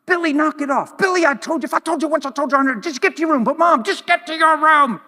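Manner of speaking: put-on high, loud scolding of a child; exasperated parent shouting at Billy; angry parent yelling. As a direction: snap at the kid to get to his room.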